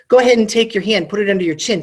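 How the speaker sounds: usual speaking voice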